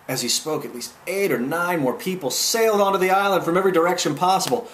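usual speaking voice

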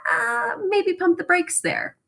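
high, drawn-out mock caution; hesitant caution. Dad tactfully tells his snobbish son to slow down, hesitant caution.

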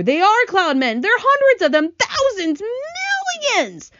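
high, loud cries of alarm; panicked. A narrator's reading. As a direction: cry out in rising horror. Panicked sighting of the cloud men.

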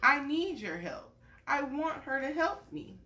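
gentle storybook read-aloud; reading to a child. Acted. Parent reading a picture book to a little one, gentle storytelling.

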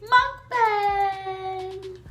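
drawn-out high excited announcement. Real, not acted. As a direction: hype up the word mukbang. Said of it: "I'm so excited".

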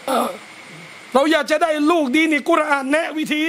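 normal talking voice

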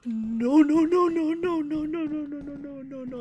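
high, loud repeated no's, fading; gasp, then plead in real panic, dying down; panicked no's. Real, not acted. Seeing spiders in the game, panicked pleading.

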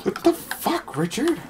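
high, hushed mock disbelief; soft echoed quote. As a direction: repeat the outrage small and squeaky. Echoes the quote more softly.

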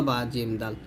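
ordinary talk

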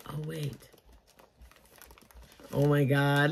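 usual speaking voice

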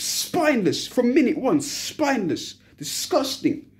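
ordinary talk, nothing marked

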